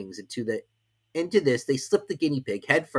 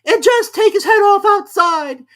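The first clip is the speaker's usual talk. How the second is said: very high, loud, slow order; shrill loud Queen; bloodthirsty Queen; 16 dB louder, slower than usual. A narrator's reading. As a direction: order the beheading. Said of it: "the Queen added".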